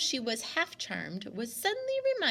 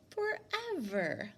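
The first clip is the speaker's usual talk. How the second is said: thoughtful echo; 8 semitones higher, 4 dB quieter, slower than usual. A narrator's reading. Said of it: "the man echoed thoughtfully".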